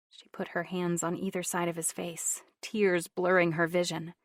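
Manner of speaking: usual speaking voice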